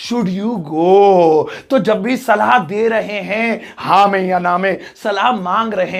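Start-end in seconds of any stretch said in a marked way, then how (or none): 0.1-1.4 very slow with a long GO; slow, sing-out classroom example; drawn-out model question. Shown as a demonstration. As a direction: stretch each word out for the class. Teacher reads out English example answer.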